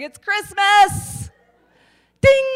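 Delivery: high, slow, squealed delight; mock delight. Nurse squealing with glee at the lit call lights. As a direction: squeal it like a kid at Christmas.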